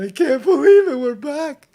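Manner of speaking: high, squeaky delight at being back; excited. Real, not acted. Host thrilled to be back on YouTube, high excited squeal.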